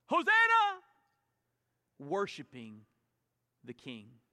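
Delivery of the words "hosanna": high, loud jubilant cry; crowd shouting. Retold or imitated. Crowd praising Jesus on the road, loud shout.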